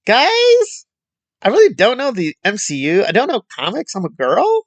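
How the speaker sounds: high, squeaky mock of a ditzy critic; mock her falsetto, playing dumb and proud of it; mocking imitation. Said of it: "she does this thing where".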